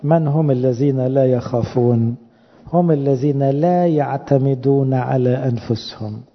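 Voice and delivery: slow quotation. Shown as a demonstration. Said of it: "قال كلمة حلوة أغسطينوس".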